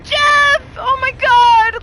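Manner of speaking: high, loud panicked screaming; real scream. Real, not acted. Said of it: "I just screamed at seven".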